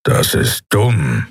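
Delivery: slow, blunt growl. A narrator's reading. Keru dismissing the admiral's plan, slow growl. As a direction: growl it out slowly. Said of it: "knurrte Keru".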